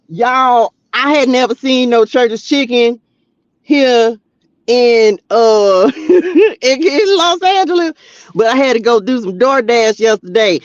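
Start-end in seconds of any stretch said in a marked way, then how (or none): 6.0-7.9 high laughing "in Los Angeles". Real, not acted. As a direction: giggle through it. Laughing mid-story.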